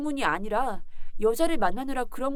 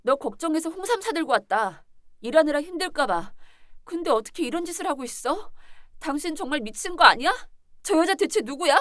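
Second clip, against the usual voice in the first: higher, louder outraged accusations; angry yelling; 4 semitones higher, 6 dB louder than usual. Acted. Betrayed wife confronts cheating husband, yelling angrily. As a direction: lay into him, voice raised in outrage. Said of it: "소리 좀 지르지 말고".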